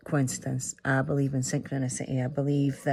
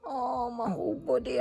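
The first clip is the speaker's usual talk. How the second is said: aching moan; 8 semitones higher, 5 dB quieter than usual. Retold or imitated. Retelling aching body.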